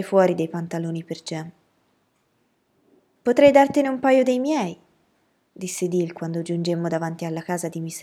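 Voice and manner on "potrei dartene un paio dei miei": put-on high, loud child's offer; eager boy. A narrator's reading. Dill offering Jem a pair of his trousers, eager boy. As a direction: pipe up brightly as a helpful little friend.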